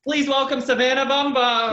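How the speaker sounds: mock announcer intro.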